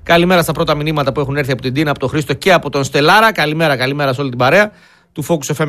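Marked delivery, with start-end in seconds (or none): none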